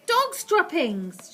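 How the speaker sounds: loud, slow disgusted yell. Acted. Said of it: "she yelled".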